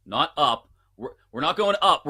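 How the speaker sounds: ordinary talk, nothing marked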